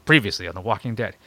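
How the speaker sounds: low, dramatic recap narrator; deep TV recap announcer voice; previously on recap. Retold or imitated. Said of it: "let me do my impression of Walking Dead".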